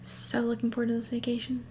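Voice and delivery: hushed, wry aside; whispered aside. Real, not acted. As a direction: murmur it as a private joke. Aside about the trip.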